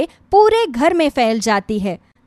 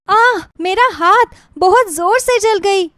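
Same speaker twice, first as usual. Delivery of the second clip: cry of pain; 7 semitones higher than usual. Acted. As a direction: yelp as if just burned. Daughter-in-law cries out in pain. (दर्द से कराहती हुई)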